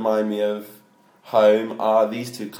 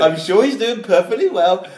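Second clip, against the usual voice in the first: higher, louder laughing copy; mocking imitation; 8 semitones higher, 6 dB louder than usual. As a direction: repeat it in a knowing mock tone. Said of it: "That sounded like you".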